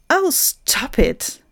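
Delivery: high, drawn-out protest; sharp interruption. Acted. Silent participant bursts into the jingle, sharp interruption. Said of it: "knallte es mitten in den Jingle".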